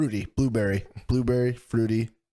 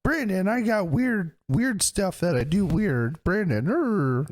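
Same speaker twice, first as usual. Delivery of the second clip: high, slow goofy mocking voice; 8 semitones higher, slower than usual. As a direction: drag it out like a dopey cartoon.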